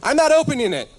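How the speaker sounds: high, panicked yell through the door; scared kid yelling back; retold scream. Scared kid yelling at the door, high mock scream. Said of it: "So I screamed".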